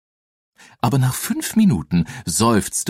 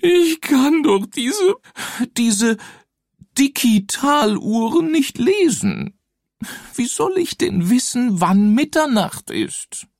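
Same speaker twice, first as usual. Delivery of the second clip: high wailing ghost voice; weeping ghost. Acted. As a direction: whine it like a helpless ghost. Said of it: "fing schon wieder zu heulen an".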